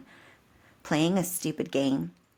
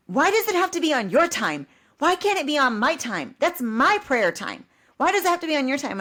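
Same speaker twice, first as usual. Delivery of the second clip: high, loud, exasperated husband; mock-indignant whine at his wife; indignant protest; 9 semitones higher, 4 dB louder than usual. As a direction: fire the questions back, rising to fast. Husband's defensive comeback, retold.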